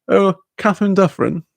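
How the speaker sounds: high mock-society greeting; hammed-up aristocrat hailing an acquaintance; posh toff. Streamer spoofing full names as high society, posh toff. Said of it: "people use their full names".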